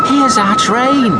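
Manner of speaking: put-on high, loud, slow child shout. A narrator's reading. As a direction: call out excitedly as the train comes. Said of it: "shouted Topsy".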